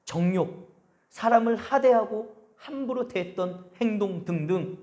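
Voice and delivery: ordinary talk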